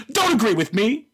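high-pitched imitation of indignation; mock indignant rebuke. Comedian rebuking the laughing conservative, mock indignation. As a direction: jump up high as if scolding. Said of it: "and she goes".

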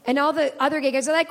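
usual speaking voice

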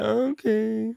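high, drawn-out little okay; daughter's okay. Retold or imitated. Young daughter answering her dad's reassurance, high mimicked okay. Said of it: "And she was like".

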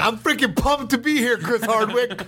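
high, excited greeting; pumped up. Real, not acted. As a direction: hype yourself up. Fighter greets host, hyped.

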